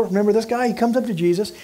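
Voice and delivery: usual speaking voice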